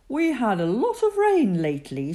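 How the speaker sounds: lightly voiced kind father bear; a calm dad bear speaking; gentle daddy bear. Daddy Bear's voice.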